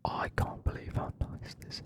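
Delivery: ordinary talk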